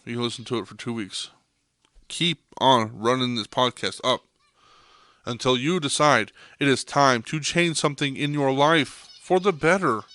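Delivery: ordinary talk, nothing marked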